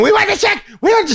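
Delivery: put-on high screaming child chant; screaming kid. Little girl screaming at the restaurant, high and shrill. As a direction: shriek it like a kid wanting to leave. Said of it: "she's screaming and yelling like".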